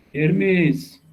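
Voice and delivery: high, drawn-out "Hermes", falling at the end; calling out. Real, not acted. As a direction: call "Hermes" across to someone not answering. Calls louder after no answer.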